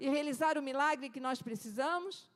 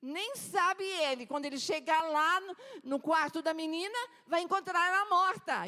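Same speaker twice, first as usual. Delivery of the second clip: mocking; 5 semitones higher, 5 dB louder than usual. Mockers continue taunting.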